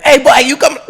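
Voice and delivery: high, loud mock captain yelling through laughter; mimicking the captain. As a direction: play the angry boat captain, laughing. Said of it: "the captain of the boat's furious".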